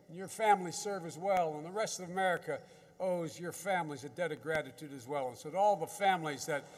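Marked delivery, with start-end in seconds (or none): none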